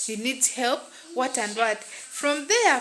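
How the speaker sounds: ordinary talk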